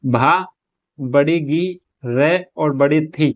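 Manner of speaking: syllable by syllable, with pauses between भा, गी, रे, थी; spelling out. Shown as a demonstration. Teacher writing Bhagirathi for students, spelling it out. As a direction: dictate each piece slowly and separately. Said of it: "वो इस प्रकार से लिखते हैं".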